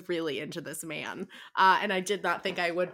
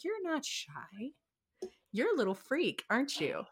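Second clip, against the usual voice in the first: sultry murmur; 4 semitones higher, 9 dB quieter than usual. Retold or imitated. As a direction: lean in and purr it to her softly. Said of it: "he's like".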